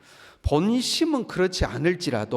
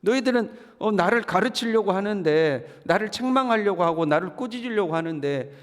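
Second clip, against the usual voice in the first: high, pained pleading to friends; anguished lament; 6 semitones higher than usual. Acted. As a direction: cry out that nobody understands. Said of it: "하고 이제 욥이 하는 거죠".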